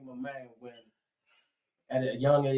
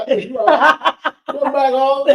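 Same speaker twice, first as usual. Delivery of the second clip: louder, higher laughing call; father calling; 6 semitones higher, 11 dB louder than usual. Retold or imitated. Father calls his runaway son home from the woods, laughing call. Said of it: "my father".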